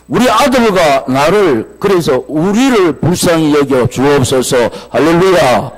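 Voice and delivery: loud, fervent plea; full-force preaching. Real, not acted. Preacher pleading as the sick boy's father, loud and fervent. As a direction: build to a loud proclamation.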